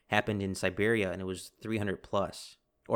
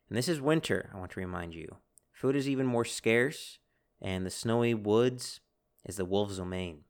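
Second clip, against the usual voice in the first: ordinary delivery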